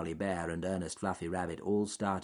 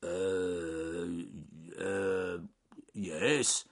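dithering bear voice; hesitant bear; much slower than usual. A narrator's reading. Bear put on the spot by rabbit, hesitant and ponderous. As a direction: stall with drawn-out hesitations.

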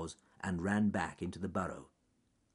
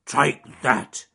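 loud, forceful growl; bear growl; 6 semitones higher, 11 dB louder than usual. Acted. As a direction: growl it as you land a thump. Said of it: "he growled".

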